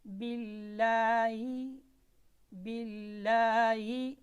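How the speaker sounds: "बिल्लाहि" recited slowly with a light, long "ला"; thin lam. Shown as a demonstration. Teacher models the rule for students, "बिल्लाहि" with thin lam. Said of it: "लाम है ये बारीक पढ़ा जाएगा".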